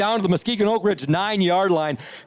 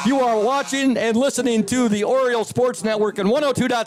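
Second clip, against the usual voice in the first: announcer; 7 semitones higher than usual. Real, not acted. Broadcast station ID read.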